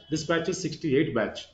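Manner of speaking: normal talking voice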